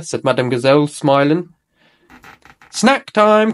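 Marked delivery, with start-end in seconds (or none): 2.7-3.5 calling out. A narrator's reading. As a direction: call the kids over. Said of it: "called Nurse Fox".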